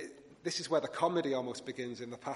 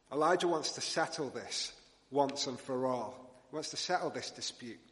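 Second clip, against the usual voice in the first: usual speaking voice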